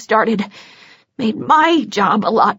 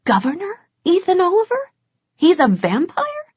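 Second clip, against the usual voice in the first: shocked disbelief; 8 semitones higher than usual. Acted.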